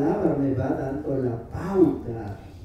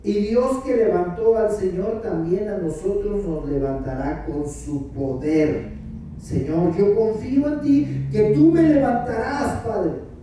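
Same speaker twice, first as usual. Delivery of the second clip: high, ardent prayer voice; fervent prayer; 10 semitones higher, 6 dB louder than usual. Acted. Pastor modelling prayer from the verse. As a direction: pray with confident fervor.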